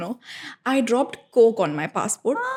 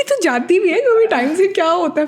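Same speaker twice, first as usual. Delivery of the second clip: mom's scolding; 8 semitones higher, 4 dB louder than usual. Retold or imitated. Imitating mom scolding, laughing.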